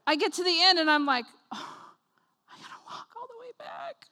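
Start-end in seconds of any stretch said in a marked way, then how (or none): 1.5-4.1 high, tiny whispered whimper; very soft, hammed-up tearful whine; mock despair. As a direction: squeak it out as if about to cry. Acts tearful realisation of mile walk back.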